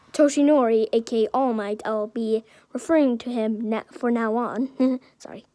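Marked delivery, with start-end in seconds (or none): none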